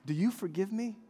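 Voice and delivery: soft, humble plea for forgiveness; tender apology. Retold or imitated. Father asking his son's forgiveness, soft and tender. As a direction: ask him quietly, gently. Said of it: "And I said".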